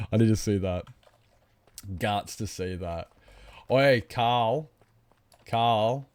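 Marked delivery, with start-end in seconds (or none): none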